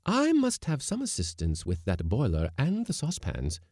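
high, firm woman's voice; decided. A narrator's reading. Lady cook demanding help from the skipper, decidedly. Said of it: "said Missus Blossom decidedly".

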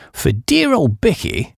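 ordinary delivery, nothing marked

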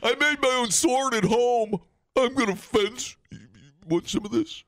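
hammed-up backyard swordsman; mock tough guy. Bragging about a homemade sword, high mock swagger. Pretend self-made-sword fencer.

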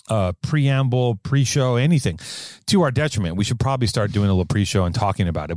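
ordinary talk, nothing marked

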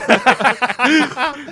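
loud, high burst of laughter; laughing. Real, not acted. Bursts out at friend's remark.

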